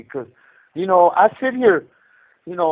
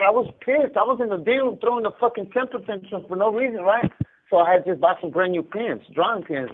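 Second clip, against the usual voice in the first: normal talking voice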